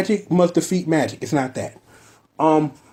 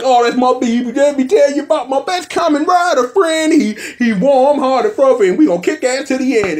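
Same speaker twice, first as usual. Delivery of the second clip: character voice; 9 semitones higher, 4 dB louder than usual. Retold or imitated. Acting out the character's speech.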